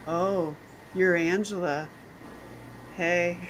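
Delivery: slow, softened knowing greeting; knowing flirty greeting. Retold or imitated. Men greeting the roommate's teacher, slow and flirty. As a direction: draw it out softly, with interest.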